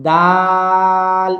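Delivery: দাল drawn out extra long and level; longer stretch. Shown as a demonstration. Teacher showing the longer stretch, দাল held extra long. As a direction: hold the vowel of দাল well beyond a beat. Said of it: "একটু বেশি টান".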